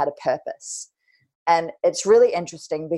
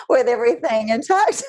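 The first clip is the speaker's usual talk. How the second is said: laughing. Real, not acted. Laughing at her own joke.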